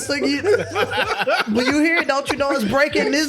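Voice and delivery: laughing. Real, not acted. Laughing through words.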